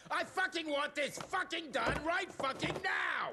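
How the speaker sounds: high, yelled furious demand; furious shout. Acted. Secretary ordering the doctor, furious shout. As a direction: scream the order at the whole room.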